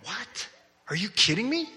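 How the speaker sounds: high, incredulous retold "what?"; shocked disbelief. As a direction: react as if you can't believe your eyes. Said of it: "And I'm like".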